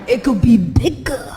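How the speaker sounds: lightly mimicked Puerto Rican boyfriend, drawn out. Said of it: "'Cause Puerto Rican men".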